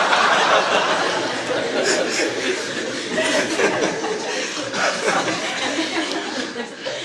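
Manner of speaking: long, high, breathy laugh; real laughter at his own joke. Real, not acted.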